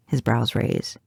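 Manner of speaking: usual speaking voice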